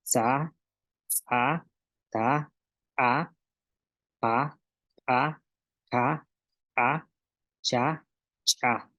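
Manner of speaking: repetir "sa", "ta", "pa", "ka", "cha" con glotal marcada; sílabas cortas y separadas, como ejercicio; sílabas con glotal otra vez. Shown as a demonstration. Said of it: "Una vez más". (maestra repite las sílabas para la clase)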